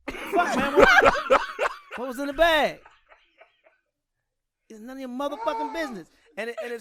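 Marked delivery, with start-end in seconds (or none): 0.1-2.8 high, loud, laughing demand; demanding Eddie Murphy. Retold or imitated. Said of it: "He was like". 4.7-6.1 loud punchline. Retold or imitated. Punchline retold again.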